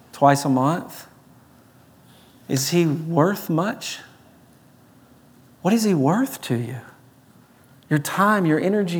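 ordinary talk

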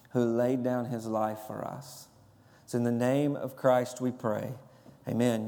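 low, hushed pastoral prayer. Acted. Pastor praying over his congregation, low and hushed.

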